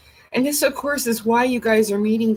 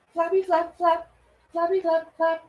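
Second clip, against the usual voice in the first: playful; 8 semitones higher than usual. Real, not acted. Showing wings, playful high voice.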